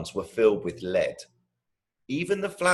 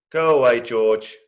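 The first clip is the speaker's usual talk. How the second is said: grumpy brush-off; 3 semitones higher, 8 dB louder than usual. Acted. Grumpy big brother.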